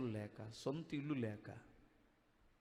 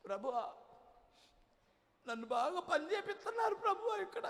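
much higher, whiny mocking wail of a complaining minister; hammed-up high whimpering prayer voice; mock whining prayer. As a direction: lampoon a self-pitying preacher crying to God. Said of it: "మన పరిచారకులు అలా ఏడవరు".

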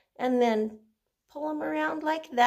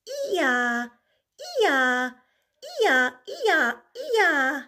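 braying ee-yaw climbing higher and louder; donkey bray; 4 semitones higher, 5 dB louder, much slower than usual. Acted. Singing the donkey's bray for the kids, braying. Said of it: "a funny donkey sound".

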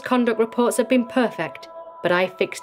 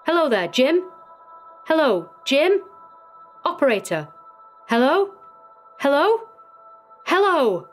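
raised, urgent calls down the phone; anxious calling out; 7 semitones higher than usual. Acted. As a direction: keep calling for an answer, pitch climbing. Warden calls into a silent line.

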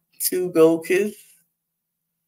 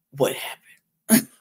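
hushed, breathless "what happened"; eager whisper; 4 semitones lower, slower than usual. Real, not acted. Host teasing tonight's episode, eager hushed whisper. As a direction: whisper it like a secret you can't wait for.

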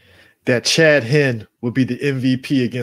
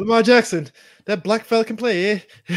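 higher, light Canadian fan lilt; hinted Canadian accent; 7 semitones higher than usual. Retold or imitated. Cohost jokes as Buffalo-area Canadian fan, lightly accented. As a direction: play a friendly Canadian fan.

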